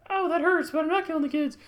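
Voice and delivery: high, loud hammed-up pain and denial; Sarah. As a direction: protest through the shock in a high voice. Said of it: "And she's like".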